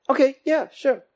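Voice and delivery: high, sugary fake compliance; mock cheerful yes-man voice; overly sweet, hiding annoyance; fake agreeable. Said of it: "Passive-aggressive people".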